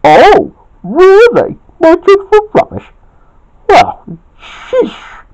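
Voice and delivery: very high, loud, affronted comeback; indignant shout. A narrator's reading. Chuck answers Bobby's insult about his jokes, indignant shout. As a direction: shout it back in high-pitched disbelief. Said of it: "shouted Chuck".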